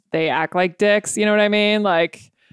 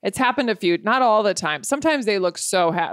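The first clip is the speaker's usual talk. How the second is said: usual speaking voice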